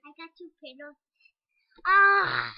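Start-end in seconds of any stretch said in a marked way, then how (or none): 1.8-2.5 playful shriek. Real, not acted. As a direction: let out a loud squeal of play. Child shrieking at play.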